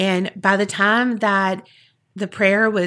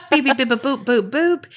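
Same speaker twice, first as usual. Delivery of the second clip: higher playful beeps through a laugh; mock censor beeps; 4 semitones higher, faster than usual. Host bleeping out the name, laughing mock beeps.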